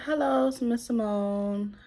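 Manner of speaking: slow, lightly put-on clinical voice; a hint of a formal nurse greeting; mimicking the doctor's polite greeting, lightly voiced; imitating the doctor. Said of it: "So she's like".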